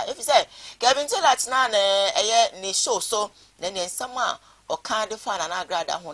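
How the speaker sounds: loud, high, animated build-up; excited telling. Real, not acted. Host telling viewers about the pastor feud, loud and excited. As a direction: sell the drama of the feud.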